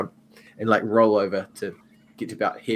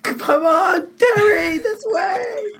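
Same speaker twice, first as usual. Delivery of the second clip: very high, drawn-out shout to a companion; high-pitched squealing holler; excited calling out; 7 dB louder, much slower than usual. Acted. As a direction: shriek with glee, urging a friend along. Player character calls companion while running.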